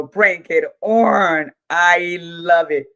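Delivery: loud, slow, delighted cheer; loud happy cheer for the team; excited cheer. Real, not acted. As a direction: savour every word of the cheer.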